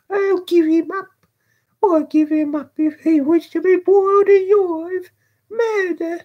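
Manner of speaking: put-on very high, loud, agitated boy; frenzied high-pitched calls for help; boy's frantic cries. A narrator's reading. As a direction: cry out wildly in a high child's voice. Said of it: "Pouring out these cries".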